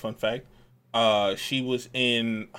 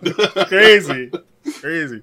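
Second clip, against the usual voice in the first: laughing; 6 semitones higher, 11 dB louder, slower than usual. Real, not acted. Overwhelmed cohost after teasing.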